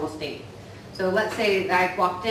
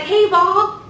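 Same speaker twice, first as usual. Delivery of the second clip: higher, louder bright hello across the room; cheery greeting; 12 semitones higher, 8 dB louder than usual. Retold or imitated. Walking in and greeting a coworker, loud cheery hello. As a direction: call out a big friendly hello. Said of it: "I walk in a room and I'm like".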